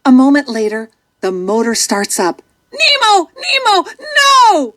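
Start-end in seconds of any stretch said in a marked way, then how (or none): 2.7-4.7 desperate shout. A narrator's reading. Father chasing the boat that took his son, desperate shout. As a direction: scream after the speedboat as it leaves. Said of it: "shouts Marlin".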